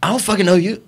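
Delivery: mock indignant retort, not shouted; retold hostile reply. Retold hostile reply from other woman.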